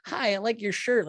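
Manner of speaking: high, perky mock compliment; overly nice. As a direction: imitate a gushing stranger being nice. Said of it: "overly nice to me".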